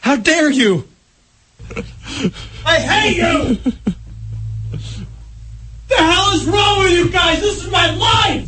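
outraged shouting. Real, not acted. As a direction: yell it like a betrayal. Blaming coworkers for the loss.

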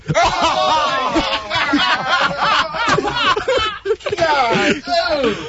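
howling laugh. Real, not acted. Host howls at the vomiting.

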